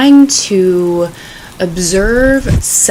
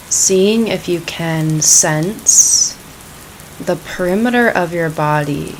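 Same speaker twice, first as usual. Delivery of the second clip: calm, unhurried meditation voice; guided meditation; 9 dB quieter than usual. Acted. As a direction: ease them gently into the practice.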